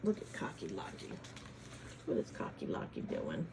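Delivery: soft whispered wonder, low; quiet aside. Acted. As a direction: lean in and hush the kids. Reading to kids, aside.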